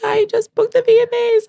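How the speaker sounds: very high, loud mock-sobbing announcement; tearful excitement. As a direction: reenact breaking the big news to mom. Retells sobbing call to mom.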